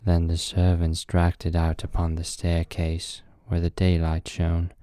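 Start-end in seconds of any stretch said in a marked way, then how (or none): none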